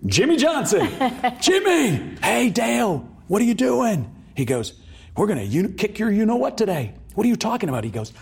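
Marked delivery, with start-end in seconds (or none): 0.1-4.1 high, loud holler between drivers; calling out. Retold or imitated. Storyteller acts out a driver hailing his rival, high loud holler. 5.2-7.8 loud, high-pitched acted taunting; boisterous trash talk. Storyteller acts drivers ribbing before the race, loud and high.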